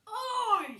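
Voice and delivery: loud high "Oi!" yelled across the garden; shout. Acted. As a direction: bark it at the thief. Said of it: "shouted Billy".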